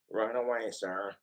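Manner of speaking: low, soft obedient aide; Smithers. Acted. Smithers obeys his boss, low soft voice. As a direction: answer dutifully, dropping pitch and volume. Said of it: "My Simpsons voices".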